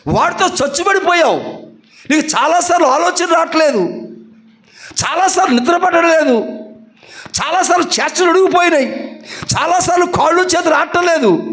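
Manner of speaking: high, driving preacher's cry; fervent preaching. Acted. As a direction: press each line on the congregation. Preaching at full force.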